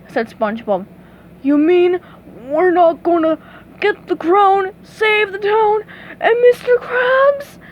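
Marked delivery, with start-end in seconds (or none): none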